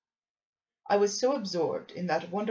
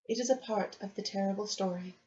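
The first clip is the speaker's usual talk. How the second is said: plain reading voice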